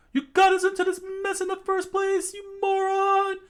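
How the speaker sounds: very high, loud, fast yelling; high angry scolding. Acted. Girl yells at her friend for the mess, high loud fast. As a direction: scold him shrieking.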